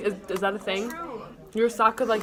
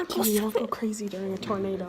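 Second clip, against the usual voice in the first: whispering; 4 semitones lower, 5 dB quieter, faster than usual. Pretend. Pretending a tornado, whispering.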